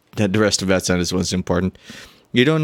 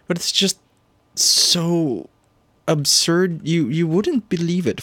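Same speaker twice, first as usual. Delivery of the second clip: higher voice, halting between words; lightly voiced hesitant confider; 9 semitones higher, much slower than usual. Hopper admits the absurd favor to a questioner, haltingly. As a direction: draw out the pauses, pitched up in disbelief.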